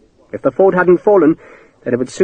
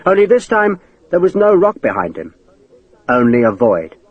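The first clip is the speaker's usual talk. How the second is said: plain reading voice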